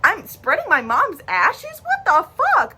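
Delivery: high, indignant venting; worked-up retelling of being furious; retold pissed-off rant. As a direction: act out outraged disbelief, pitch climbing to the end. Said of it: "I was pissed. I'm like".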